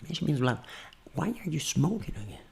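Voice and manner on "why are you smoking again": very soft, private question; intimate voice. Shown as a demonstration. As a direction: ask it under your breath. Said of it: "voz íntima". (voz íntima, pergunta baixinha)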